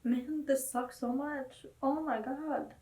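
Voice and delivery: soft, slow, tearful words; choked up after the ending hit; real tears, barely voiced; crying quietly. Real, not acted. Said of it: "bawling my eyes out".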